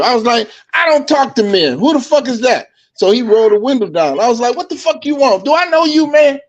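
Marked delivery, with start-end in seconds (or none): 0.7-2.7 higher-pitched, indignant storytelling quote; retold scornful refusal. Retold confrontation with a stranger. 4.6-6.4 high-pitched challenge at normal volume; retold hostile questioning. Retold confrontation with a stranger.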